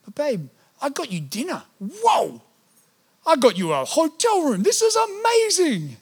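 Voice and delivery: rising, building excitement to a high peak; proudly showing off dinner and the hotel; retold excited husband. Retelling how he showed his wife the surprise, growing excited. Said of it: "When I was with my wife in Brisbane".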